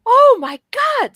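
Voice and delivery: high, slow stunned gasp; drawn-out shocked reaction. Retold or imitated. Viewer blindsided by the cat episode, stunned. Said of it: "And it's just like".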